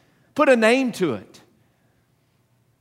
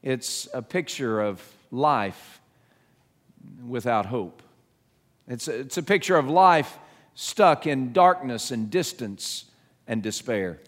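ordinary talk

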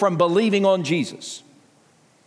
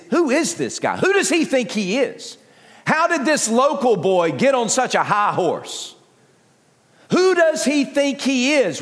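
higher, indignant mocking of a local boy; scornful townsfolk; 5 semitones higher than usual. Hometown crowd scoffing at Jesus, indignant and mocking. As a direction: scoff at him as a nobody.

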